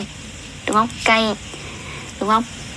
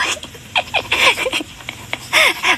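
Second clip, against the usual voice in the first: real high laugh. Real, not acted. Laughs at her own slip.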